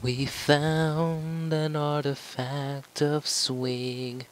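high, very slow put-on voice; mock awe. Gamer announcing an artifact, slow high mock awe. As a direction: intone it like a grand discovery.

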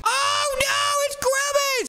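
high, fake-scared squeal; mock fright. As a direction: shriek at the monster. Sees the monster at the window.

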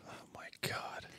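soft unvoiced oh my God; stunned whisper. Real, not acted. Dad reacting in a whisper to his son saying LeBron. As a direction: breathe it out in disbelief.

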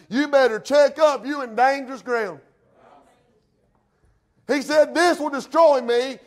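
loud, high warning from the pulpit; full-force preaching. Real, not acted. Preacher warns those comfortable in sin, full-force preaching. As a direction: call the congregation to attention and warn them hard.

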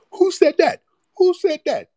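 loud, squealing falsetto mockery; mock playing dumb. Host imitating commenters in the comment sections, loud high mock bafflement. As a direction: squeak it out in exaggerated feigned ignorance.

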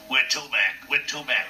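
repeating the cabbie's side-of-mouth line to learn it; practising cabbie voice. Shown as a demonstration. Puppeteer practising the cab driver's voice after the ride, side of the mouth. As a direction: drill the cabbie's voice out the corner of your mouth. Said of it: "I'm trying to learn to do this voice".

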